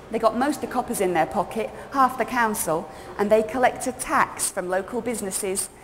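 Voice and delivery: put-on high, loud, gossipy London girl; bubbly cockney girl spilling gossip; chatty young Londoner. Character narration continues.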